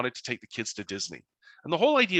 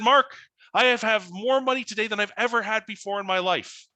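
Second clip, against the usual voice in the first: excited; 8 semitones higher, 5 dB louder than usual. Retold or imitated. Dan's proud news.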